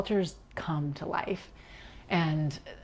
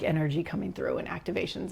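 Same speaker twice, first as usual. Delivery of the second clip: ordinary talk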